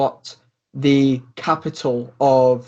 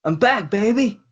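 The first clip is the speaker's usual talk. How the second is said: a lightly raised, friendly bro voice; mildly voiced robot brother; 6 semitones higher than usual. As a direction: call out to your bro, a bit higher. GM voices Finite calling his brother.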